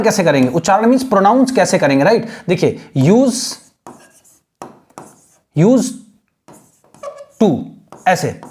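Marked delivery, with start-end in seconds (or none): 3.0-7.6 used spelt out, then to, the d barely sounded; slow pronunciation demo. Shown as a demonstration. Teacher slowly sounding out used to for students. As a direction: drag out "used" then "to", swallowing the d. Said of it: "used to पूरा नहीं पढ़ेंगे".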